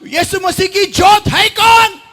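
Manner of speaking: high, shouted challenge to the congregation; impassioned preacher's shout; full-force preaching. Real, not acted. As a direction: fire the question out, fast and high.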